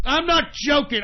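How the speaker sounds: ordinary delivery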